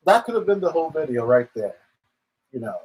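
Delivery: ordinary talk, nothing marked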